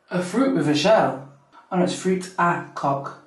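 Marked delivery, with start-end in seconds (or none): none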